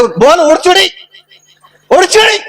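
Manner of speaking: high-pitched demonstrated phrase, rising at the end; instructing call. Acted. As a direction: dictate it in a high, lifted call. Angry farmer dictates the correct phrase.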